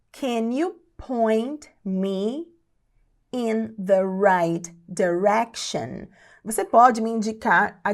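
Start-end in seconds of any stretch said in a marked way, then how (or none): 0.1-6.1 very slow, spaced word by word; slow teaching pace. Shown as a demonstration. English teacher models asking for directions, very slowly. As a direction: draw out each word with pauses.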